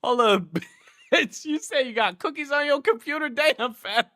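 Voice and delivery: high-pitched, laughing disbelief; cracking up. Real, not acted. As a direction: blurt it out through laughter. Reading a chat message, cracking up.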